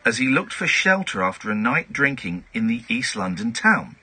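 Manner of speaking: measured newsreader delivery, lightly mocking; news read. Host reading a tabloid story on air in newsreader style. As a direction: read the odd story like a bulletin.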